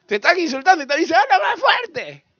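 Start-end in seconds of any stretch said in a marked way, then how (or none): 1.2-2.3 slow, high, loud shout; exaggerated loud request; calling out. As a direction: stretch it out in a high yell. Acts calling back at insults.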